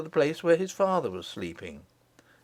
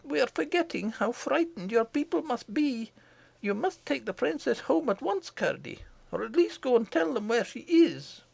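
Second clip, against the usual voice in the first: mother's voice, pitched much higher; mother to her son, higher, gently urgent; 11 semitones higher, 4 dB quieter than usual. Acted. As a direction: urge him home like a worried mother. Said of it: "said the mother".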